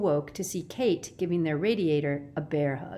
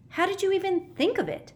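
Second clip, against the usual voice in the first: put-on high, eager young boy; boy character voice. A narrator's reading. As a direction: ask it wide-eyed and impressed. Sticky voiced in story.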